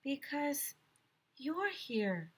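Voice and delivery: soft, high shy voice; shy girl whispers to her teacher; whispered. A narrator's reading. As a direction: barely say it, embarrassed. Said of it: "she whispered".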